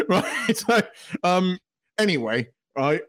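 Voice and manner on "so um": ordinary talk, nothing marked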